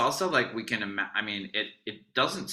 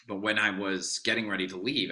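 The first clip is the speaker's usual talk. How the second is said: ordinary talk, nothing marked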